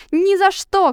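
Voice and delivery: high, loud refusal; shout. A narrator's reading. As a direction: yell it flatly. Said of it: "крикнул дикарь".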